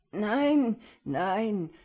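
put-on an old man's high, loud, panicked stammer; frightened stammer. A narrator's reading. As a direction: blurt the nos out in fear. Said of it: "stammelte der Alte". (ängstlich gestammeltes, lautes Nein)

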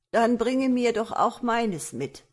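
a hint of an ancient ferrywoman, asking eagerly; old ferrywoman. A narrator's reading. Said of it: "Da saß eine steinalte Frau". (leicht angedeutete alte Fährfrau)

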